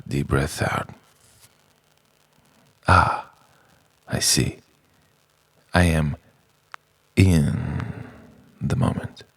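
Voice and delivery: soft, slow breathing guidance; guided meditation. Acted. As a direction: guide the breath gently, trailing into whisper.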